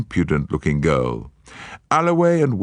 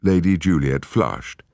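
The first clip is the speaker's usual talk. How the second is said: ordinary delivery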